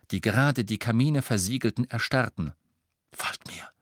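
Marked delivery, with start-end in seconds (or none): 3.1-3.7 hushed, voiceless command; whisper. A narrator's reading. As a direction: whisper it secretly to the group. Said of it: "flüsterte er der Gruppe".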